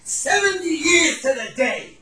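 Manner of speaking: slow, loud, high proclamation; stretched, ringing declaration; climactic revelation. Retold or imitated. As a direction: cry out the retold realisation word by word. Old man's climactic realisation, acted.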